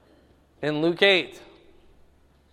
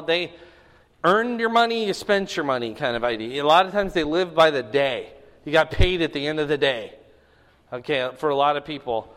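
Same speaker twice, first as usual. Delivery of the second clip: ordinary talk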